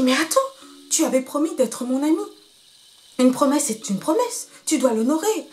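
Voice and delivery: a small monkey reminding him of his promise; pleading little monkey. Acted.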